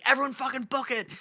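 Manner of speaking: high-pitched mock yell; urgent yell, volume held back; retold scream. Imitating driver screaming at friends to run, high mock yell. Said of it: "Connor's literally screaming".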